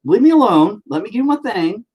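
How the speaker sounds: exasperated order. Real, not acted. Father shooing child away.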